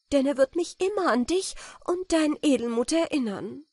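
high, impassioned promise; fervent gratitude. A narrator's reading. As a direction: vow it with full feeling. Countess pledges lasting gratitude.

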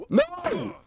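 sudden high shriek of dismay. Real, not acted. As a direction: yelp out in frustration. Sudden failure in game.

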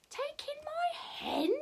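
high, soft, incredulous query; puzzled question. Acted. Old lady doubts the wise man's advice, puzzled. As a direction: question it slowly in a small high voice.

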